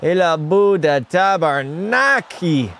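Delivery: put-on slow, drawn-out French-accented exclamation. Streamer celebrating the Canadiens' lead, French accent. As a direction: stretch each word in a put-on French accent. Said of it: "Stop with the French accent".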